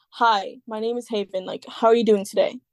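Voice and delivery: casual put-on American accent. Shown as a demonstration. Showing the friend an American accent, American-accented demo. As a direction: introduce yourself in an American accent. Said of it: "I can do an American accent".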